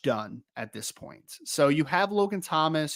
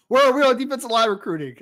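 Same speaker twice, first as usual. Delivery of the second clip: louder and higher, punching the question out; loud re-read; 8 semitones higher, 7 dB louder than usual. Real, not acted. Host rereading a listener's question, louder and higher. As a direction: announce the question again with fresh gusto. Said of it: "Oh, oh yeah, here we are".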